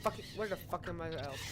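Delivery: ordinary talk, nothing marked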